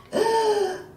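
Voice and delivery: high, sharp gasp; sudden high intake of pain. A narrator's reading. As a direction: gasp as if struck by a lash. Sarah struck by the whip.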